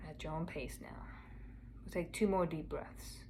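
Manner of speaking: soft meditation voice with long pauses; guided meditation. Acted. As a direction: gently guide a listener inward, unhurried and quiet.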